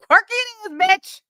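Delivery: high, loud, mocking jab; sassy taunt. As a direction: throw the name back at her with attitude. Mocking the name Corky chose.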